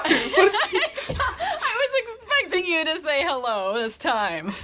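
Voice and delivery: high, slow, through laughter; laughing. Real, not acted. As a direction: say it while cracking up. Laughing at cohost.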